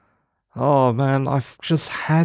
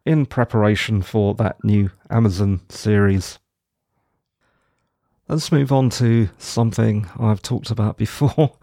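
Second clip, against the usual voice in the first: ordinary talk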